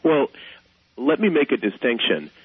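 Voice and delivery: normal talking voice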